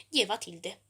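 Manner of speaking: usual speaking voice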